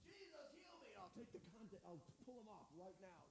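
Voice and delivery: whispered plea for healing; hushed prayer. Retold or imitated. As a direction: murmur the prayer under your breath. Hushed desperate prayer retold.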